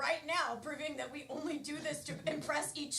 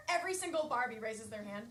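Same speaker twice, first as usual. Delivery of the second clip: usual speaking voice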